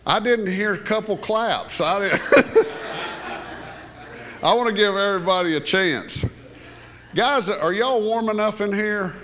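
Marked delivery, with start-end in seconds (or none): 2.3-4.4 high breathy burst of laughter; real laugh. Real, not acted. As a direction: laugh it off at the missing claps. Laughs at missing applause.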